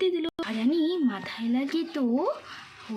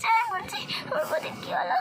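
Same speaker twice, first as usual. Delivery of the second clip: high-pitched distressed cries; panicked; 6 semitones higher, 3 dB quieter than usual. Acted. Girl seeing her sister-in-law attacked, high panicked cries. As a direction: cry out high in horror.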